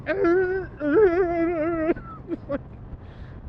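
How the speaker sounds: high, loud screeching yell; imitated drunk screaming song; mock screaming. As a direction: belt out a silly wordless scream. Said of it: "stupid fucking screaming and yelling".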